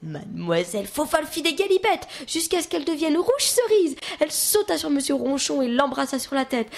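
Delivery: ordinary delivery, nothing marked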